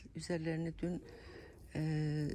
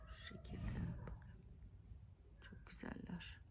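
whispering; 11 dB quieter than usual. Real, not acted. Shows plants up close.

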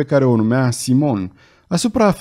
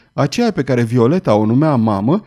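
ordinary delivery, nothing marked

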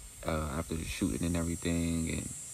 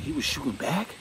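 surprised question; 8 semitones higher, 3 dB louder than usual. Retold or imitated. Shocked repeat.

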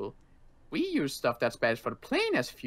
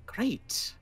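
high, sarcastic exasperated great; 11 semitones higher than usual. Acted. Fed-up survivor grumbles about the Colonel, sarcastic. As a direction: roll the eyes through it.

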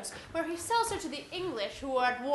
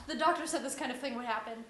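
normal talking voice

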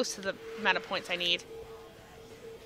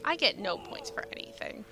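dejected whisper under her breath; 4 semitones lower, 10 dB quieter than usual. Real, not acted. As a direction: breathe it out, defeated. Complaining alone to herself.

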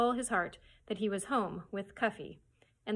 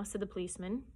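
usual speaking voice

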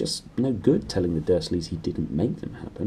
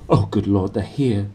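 mild frantic. A narrator's reading. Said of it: "said Aunt Petunia frantically".

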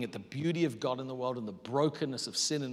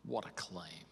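hushed musing; 9 semitones lower, 14 dB quieter, slower than usual. Shown as a demonstration. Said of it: "And I'll just be like".

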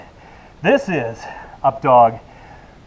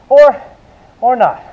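playful tease; 9 semitones higher, 5 dB louder than usual. Real, not acted. Teasing aside to viewers.

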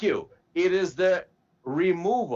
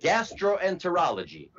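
usual speaking voice

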